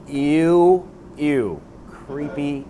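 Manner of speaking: slow, drawn-out "ew" of disgust; mock disgust. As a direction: recoil in drawn-out distaste. Reacts in disgust to quote.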